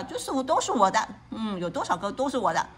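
usual speaking voice

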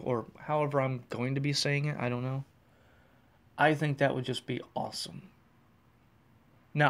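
normal talking voice

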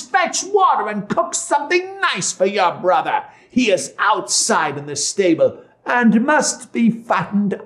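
high-pitched witch giving orders; wicked witch, bossy. Acted.

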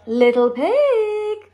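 high, loud, stretched hail; calling out. A narrator's reading. Wolf inviting pig to the fair, calling out. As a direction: sing out the call, lingering on the last word. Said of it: "called the wolf".

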